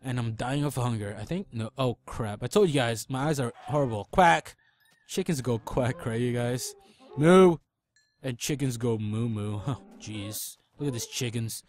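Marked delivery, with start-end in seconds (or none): 4.1-4.6 loud playful duck quack; mock quack. Quacking at chickens. 7.2-7.6 loud high playful moo; mock moo. Mooing at chickens.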